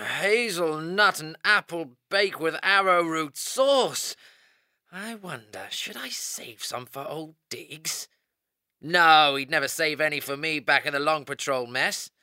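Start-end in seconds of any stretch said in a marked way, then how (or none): 4.9-8.1 teasing aside. Acted. Teasing aside over food.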